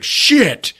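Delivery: high, sudden dismayed curse; startled curse. Retold or imitated. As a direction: blurt it in shock. Gun clicks empty.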